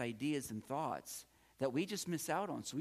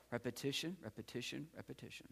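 each repetition softer, trailing away to nothing; fading repetition; 7 dB quieter, faster than usual. Shown as a demonstration. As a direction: chant it, fading out. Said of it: "repetition is the key".

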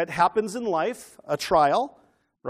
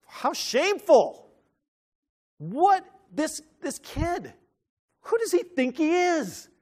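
much higher, slow, appalled gasps of disapproval; scandalized Pharisee; 8 semitones higher, slower than usual. Acted. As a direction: play an outraged religious leader clutching his pearls. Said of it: "You're a Pharisee. What are you thinking".

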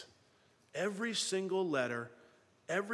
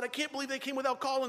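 flustered rush; 7 semitones higher, faster than usual. Retold or imitated. Flustered host before unexpected guest.

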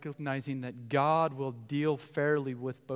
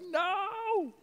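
mock surprise. Joseph and Mary reacting.

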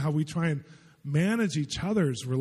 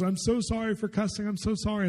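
apologetic, higher voice; 7 semitones higher than usual. Retold or imitated. Friend repeats her apology, retold.

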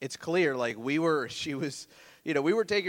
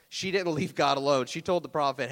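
ordinary talk

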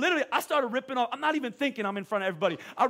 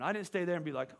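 ordinary talk, nothing marked

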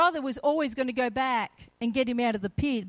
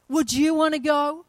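usual speaking voice

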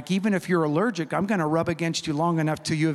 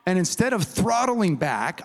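ordinary delivery, nothing marked

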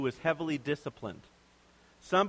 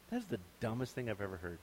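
scornful put-down; 6 semitones lower, 10 dB quieter than usual. Pretend. Imagined dismissive Jesus.

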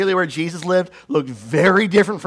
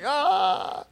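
mock weepy wail; 9 semitones higher, 3 dB quieter than usual. Guests crying at wedding.

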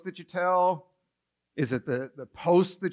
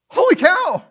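much higher, louder cry of disbelief; loud stunned exclaim; 11 semitones higher, 9 dB louder than usual. Pretend. Preacher overwhelmed by the call to holiness, loud astonished cry. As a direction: throw it out, overwhelmed.

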